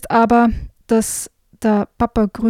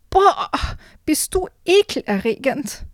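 shrieking voice; 7 semitones higher than usual. Acted. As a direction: shriek in disgust like a giant princess. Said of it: "eine kreischende Stimme".

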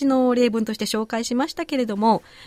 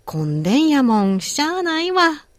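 「混んでんやもん、しゃあないわ」 in Osaka intonation, slow on しゃあないわ; Osaka dialect. Shown as a demonstration. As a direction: model it like an Osaka local. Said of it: "Repeat after me". (大阪弁のお手本として「混んでんやもん、しゃあないわ」)

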